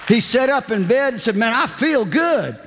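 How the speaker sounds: high, eager retelling; woman's excited testimony. Retold or imitated. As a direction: tell it high and breathless with joy. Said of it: "She goes".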